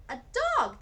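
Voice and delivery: loud, high delighted shout; excited shout. A narrator's reading. Small boy shouting to the arriving family, excited. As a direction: shout it out with joy. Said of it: "Petey shouted".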